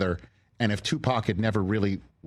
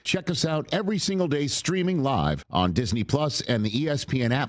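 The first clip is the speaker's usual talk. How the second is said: quick, bright host sign-off read, pitched up; upbeat show outro; 6 semitones higher than usual. Acted. Host thanking listeners and plugging the show, brisk promo read. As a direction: rattle off the plug like a radio promo.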